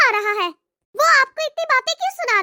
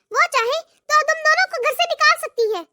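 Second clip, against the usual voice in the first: ordinary delivery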